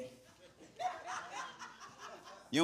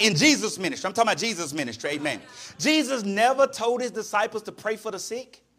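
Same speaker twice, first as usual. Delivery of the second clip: ordinary talk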